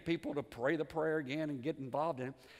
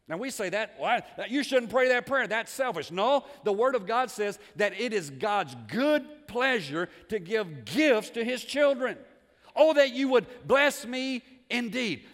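loud, raised, emphatic preacher delivery; full-force preaching; 6 semitones higher, 9 dB louder, slower than usual. Real, not acted. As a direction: drive it home with slow, weighty key words.

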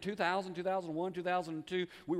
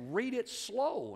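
higher, drawn-out words with a long SLOW; slow command from God; 6 semitones higher, much slower than usual. Retold or imitated. God instructs the preacher as he reads, slowly. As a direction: stretch out "slow" as God's instruction. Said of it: "God said".

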